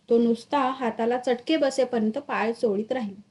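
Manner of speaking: usual speaking voice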